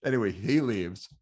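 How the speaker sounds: ordinary talk, nothing marked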